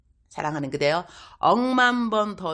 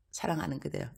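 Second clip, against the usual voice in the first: much softer, hushed repeat; soft tender address; 9 dB quieter than usual. Acted. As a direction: drop to a near-murmur on the repeat. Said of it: "repeated greeting, soft take".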